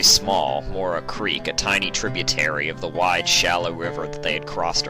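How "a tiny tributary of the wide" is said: usual speaking voice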